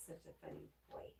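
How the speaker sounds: fast, breathless whispered coo; hushed fast whisper at the dog. Real, not acted. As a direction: whisper it fondly to the pet. Cooing quietly at the dog.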